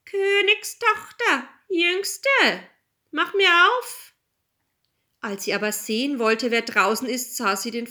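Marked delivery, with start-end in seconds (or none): 0.0-4.1 high, slow frog call. A narrator's reading. Frog calls through the door to the princess, high and drawn-out. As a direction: draw out each word, high-pitched. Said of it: "klopfte es an der Tür und rief".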